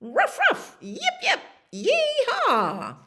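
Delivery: very high, squeaky barking into a whoop; high, gleeful barks and yee-haw; excited dog barks. Acted. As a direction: yap like an eager herding dog. Dog sets on supposed cow.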